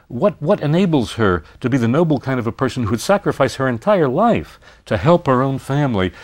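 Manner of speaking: usual speaking voice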